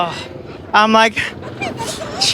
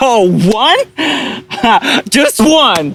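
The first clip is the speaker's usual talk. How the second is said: incredulous laugh; 5 semitones higher, much slower than usual. Acted. Skit, girlfriend mocks excuse.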